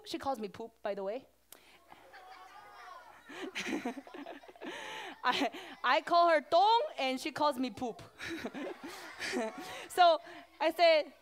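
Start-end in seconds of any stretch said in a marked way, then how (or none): 3.3-5.2 giggling fit; real helpless little laugh, softer than speech. Real, not acted. Speaker amused by her friend's silly nickname, giggling. 8.1-9.8 soft giggling; real giggle between sentences; laughing. Real, not acted. Speaker amused telling children both silly nicknames, giggling.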